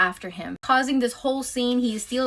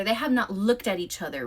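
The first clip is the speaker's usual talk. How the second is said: normal talking voice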